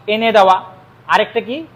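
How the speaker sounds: normal talking voice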